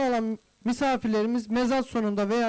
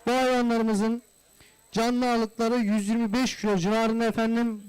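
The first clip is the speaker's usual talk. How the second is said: usual speaking voice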